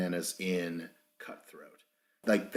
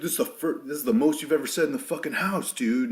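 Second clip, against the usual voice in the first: fast, higher-pitched retold rant; mock an angry housemate, rushed and a bit louder; imitating pissed-off; 6 semitones higher, 5 dB louder, faster than usual. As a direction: rattle off the irritated jab. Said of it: "C T was extremely pissed".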